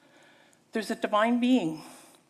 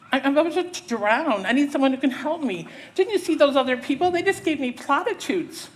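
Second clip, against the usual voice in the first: high, agitated, raised voice; desperate plea; 4 semitones higher, 3 dB louder than usual. Acted. Drowning woman to the stranger on the rope, agitated plea. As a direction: plead as if about to drown.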